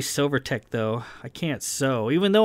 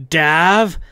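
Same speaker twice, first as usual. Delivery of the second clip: loud, raised-pitch protest; shout; 4 semitones higher, 9 dB louder than usual. A narrator's reading. Kai ready to give the captain a tongue lashing, shouting. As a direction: shout the name in exasperation. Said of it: "Kai said at a shout".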